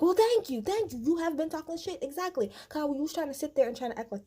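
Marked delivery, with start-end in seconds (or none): 0.0-2.0 vindicated quote. Retold or imitated. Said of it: "Kimmy's like".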